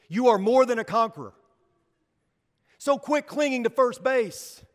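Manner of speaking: prêche fervent, plus fort; full-force preaching. Real, not acted. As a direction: haranguer la foule avec élan. Preaching climax on running the bases.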